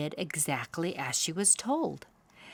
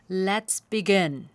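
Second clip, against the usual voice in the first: father owl, slow; 6 dB louder, slower than usual. A narrator's reading. Father owl starts lesson.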